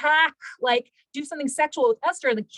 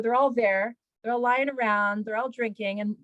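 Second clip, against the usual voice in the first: usual speaking voice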